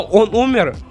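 ordinary talk, nothing marked

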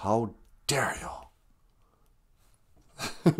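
whispered mock indignation; mock outrage. Host mimicking offended people on social media, whispered mock outrage. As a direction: whisper it as if scandalized, in jest.

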